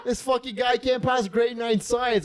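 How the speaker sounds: high-pitched, worked-up ranting through laughter; real hilarity, voice climbing high; incredulous ridicule. Real, not acted. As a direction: pitch way up in amused disbelief.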